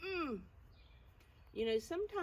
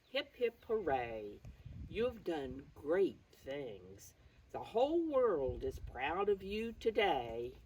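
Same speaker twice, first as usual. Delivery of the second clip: lively, slightly louder storybook reading; reading to children; 5 dB louder than usual. Acted. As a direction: cheer the children on as you read the page. Reads the book text aloud.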